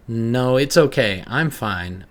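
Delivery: too insistent, trying to convince; forced, emphatic reassurance, drawn out; overly emphatic. Acted. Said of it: "I said overly emphatically".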